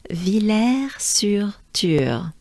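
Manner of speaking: "Villers-sur-Thur" slowly, in careful French; pronunciation demo. Shown as a demonstration. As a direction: stretch out each syllable of "Villers-sur-Thur". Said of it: "This name sounds like this".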